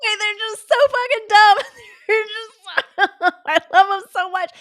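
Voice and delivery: high, loud squeal through laughter; laughing hard. Real, not acted. Streamer loses it over dumb toads, high helpless laughing. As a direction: crack up while gushing. Cracking up at toad video.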